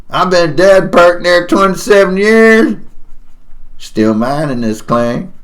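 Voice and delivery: high, hollering prospector welcome; dead miner proudly greeting strangers; crazy old prospector. Acted. Voices the old prospector he draws.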